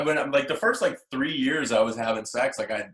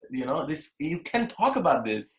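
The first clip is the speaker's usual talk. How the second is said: higher mock-scolding voice with Russian accent; imitating scolding Russian mother; 4 semitones higher than usual. Son retelling his mom's review of his show, scolding Russian accent. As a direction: forbid it as a Russian mom would, accent put on. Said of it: "She goes".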